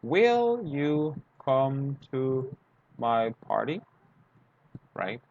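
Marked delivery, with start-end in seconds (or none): 0.0-3.8 very slow, deliberate question with a rise; slow teacher demo question; slow model question. Shown as a demonstration. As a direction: spell out each word for learners, slow. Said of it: "And you say, for example".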